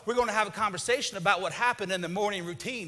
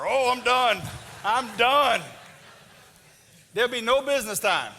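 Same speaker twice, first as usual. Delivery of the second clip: louder mock-despair groan; defeated husband; 4 dB louder than usual. Husband rolls over, mock-defeated by wife's no. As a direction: sigh it out, hammed-up defeat.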